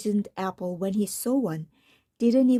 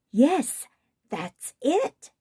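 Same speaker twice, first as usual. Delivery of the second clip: sudden excitement; 5 semitones higher, much slower than usual. Acted. Prince hits on his idea.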